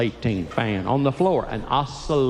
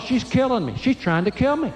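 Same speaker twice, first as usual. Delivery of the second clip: panicked thought; 8 semitones higher than usual. Retold or imitated. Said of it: "I thought".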